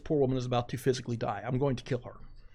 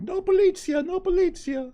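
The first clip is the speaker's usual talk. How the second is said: put-on much higher pleading "No polizia" as the kids; imitating crying kids; 4 dB louder than usual. Children begging the driver not to call police, high mock wail. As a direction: mimic frightened children begging, high and loud. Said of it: "The children immediately starts crying".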